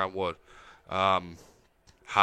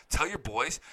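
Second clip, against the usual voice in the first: ordinary talk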